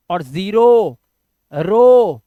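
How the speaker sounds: Zero and रो said loud, with a long final o; drawn-out o sound. Shown as a demonstration. Teacher stresses the o in zero and रो, loud. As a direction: stretch the o of रो loudly for the class. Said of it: "उसके बाद ओ के लिए ओ".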